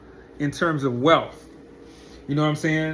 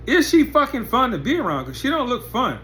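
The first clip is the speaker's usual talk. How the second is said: incredulous; 7 semitones higher than usual. Real, not acted. Mocking the woman's appeal.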